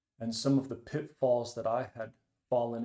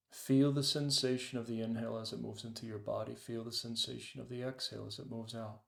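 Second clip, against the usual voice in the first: guided meditation. Acted. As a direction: ease them into the breath, unhurried. Leads a meditation.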